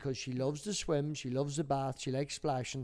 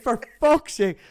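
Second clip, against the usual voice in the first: higher, louder exasperated coaxing; exasperated urging; 10 semitones higher, 8 dB louder than usual. Retold or imitated. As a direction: plead with the stubborn toddler to jump. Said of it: "I'm like".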